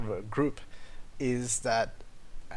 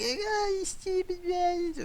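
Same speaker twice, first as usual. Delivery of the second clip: much higher, squeaky outraged woman voice; mock angry woman; 3 dB quieter, much slower than usual. Mocking the driver who lost the parking spot, shrill high voice. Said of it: "And she's like".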